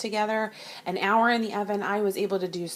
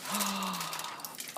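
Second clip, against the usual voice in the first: surprised gasp; 8 dB quieter than usual. Real, not acted. Opening the finished dish.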